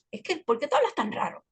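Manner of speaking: put-on high, puzzled little-girl voice; daughter imitation. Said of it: "ella misma me decía".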